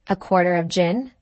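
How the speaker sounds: usual speaking voice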